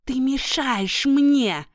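high, slow furious hiss; hissing witch. Acted. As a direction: spit it out like a wicked witch. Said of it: "прошипела она".

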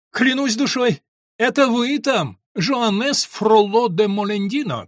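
high, drawn-out call across a hall; schoolboy hails his friend in the hall, calling out high and slow. A narrator's reading. One student calls to another perched above, hailing loudly. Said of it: "кричал один из них другому".

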